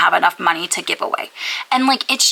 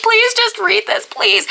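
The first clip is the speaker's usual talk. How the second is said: high pleading; 13 semitones higher than usual. Retold or imitated. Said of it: "I was like".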